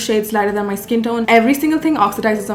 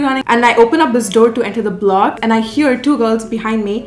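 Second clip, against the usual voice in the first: ordinary talk, nothing marked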